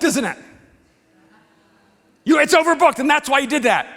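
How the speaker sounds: loud, heated, accusing; angry accusation. Retold or imitated. Accusing gate agent.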